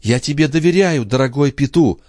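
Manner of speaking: high-pitched sincere protest; acted heroine protesting her trust; earnest objection. A narrator's reading. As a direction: reassure a doubting friend insistently. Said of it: "возразила Катрин".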